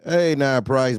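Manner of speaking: ordinary talk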